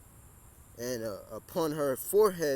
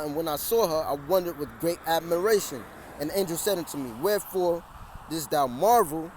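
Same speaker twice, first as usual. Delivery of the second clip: loud, rising scripture preaching; fiery street sermon over traffic; 4 dB louder than usual. Real, not acted. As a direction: proclaim it to the street. Reads scripture aloud on street.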